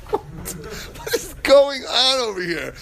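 high-pitched, laughing "what is going on"; cracking up while retelling the panic; laughing disbelief. Retold or imitated. Telling friends about the subway hole, laughing in disbelief.

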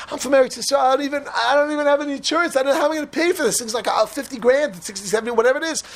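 high-pitched fretting, no real sobs; mock tearful. Uninsured patient worrying about the bill, mildly tearful. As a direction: imitate an upset man pleading. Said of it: "just bursts out crying".

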